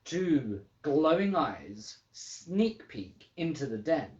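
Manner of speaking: plain reading voice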